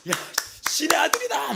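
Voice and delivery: high, loud jubilant shout; cheering shout. Retold or imitated. Friends hailing the exempted boy, high jubilant shout. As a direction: cheer him like a miracle happened.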